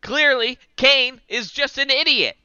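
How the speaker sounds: lightly ape the commentator's smug verdict; imitating the King. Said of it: "he was like".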